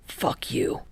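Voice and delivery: whispered gripe after hurting an elbow; griping. Acted. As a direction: hiss it out in pain. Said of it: "Kageyama gripes".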